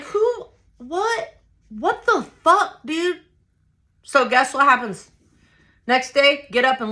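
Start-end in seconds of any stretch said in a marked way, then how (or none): 0.0-3.2 high, drawn-out disbelief; stunned disbelief. Retold or imitated. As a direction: sputter it high, acting out the shock. Acting stunned reaction after fight.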